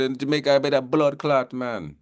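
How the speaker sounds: high-pitched attempt at a Jamaican accent; casual mock-Jamaican lilt. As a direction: lightly put on a Jamaican accent. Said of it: "That's my Jamaican accent".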